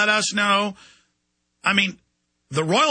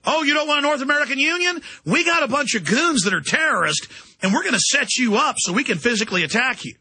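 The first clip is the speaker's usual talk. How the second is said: high, quick mocking threat; mock bullying authority; 6 semitones higher, faster than usual. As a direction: play a taunting official threatening protesters. Voices authorities threatening protesters.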